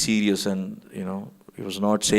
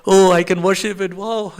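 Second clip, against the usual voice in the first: put-on childlike joy; 6 semitones higher than usual. Guru getting his mended Shila back, childlike happy exclamations. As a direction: gush like a child given a toy. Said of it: "he was so happy".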